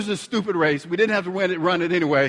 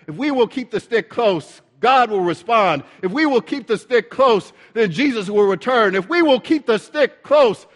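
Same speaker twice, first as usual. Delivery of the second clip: rising, louder preacher's refrain; building preaching; 3 semitones higher, 4 dB louder than usual. Real, not acted. Preacher urging the congregation to keep faith, building fervently.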